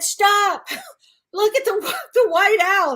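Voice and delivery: high, laughing cry of amazement; loud, high-pitched giggly disbelief; giggly astonishment. Retold or imitated. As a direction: burst out laughing as you point it out. Retells giggling gas-high reaction to owl.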